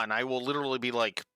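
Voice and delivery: ordinary talk, nothing marked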